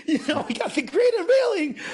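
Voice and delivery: laughing through the words, pitch jumping up; laughing as you say it. Real, not acted.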